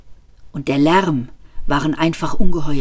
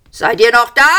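high, louder shouted call; shouting policeman; 7 semitones higher, 5 dB louder than usual. A narrator's reading. Policeman checking if they survived, shouting. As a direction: call out into the smoke. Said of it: "schrie einer der Polizisten".

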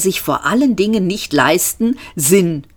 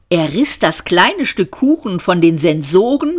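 plain reading voice

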